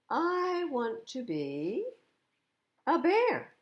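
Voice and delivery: slow build to a high, loud "bear"; announcing. A narrator's reading. PJ proudly declaring he will be a bear, rising high. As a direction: stretch it out, then burst. Bunny announcing new wish.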